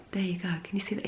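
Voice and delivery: hushed, soft and close; whispering. Real, not acted. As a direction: draw them in quietly. Leaning in to show card.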